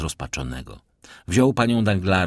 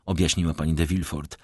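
usual speaking voice

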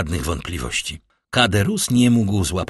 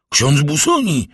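higher, louder cry of recognition; startled exclamation; 7 semitones higher, 6 dB louder than usual. A narrator's reading. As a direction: exclaim the name in startled disbelief. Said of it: "zawołał Caderousse".

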